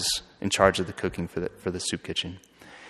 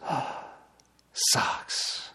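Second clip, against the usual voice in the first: soft "Oh", then a breathless whispered "socks"; awed whisper; 5 semitones higher, 6 dB quieter than usual. Retold or imitated. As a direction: let the word fall to a stunned whisper. Woman overwhelmed by gift of socks.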